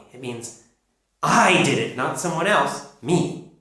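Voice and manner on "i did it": "I" punched at the start, "did it" lighter; stress on I. Shown as a demonstration. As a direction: lean hard on "I". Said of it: "I'm emphasizing I".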